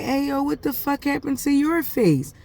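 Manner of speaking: shocked. Retold or imitated. Mom sees her daughter's hurt face, shocked high outburst. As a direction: blurt it out, stunned at what you see.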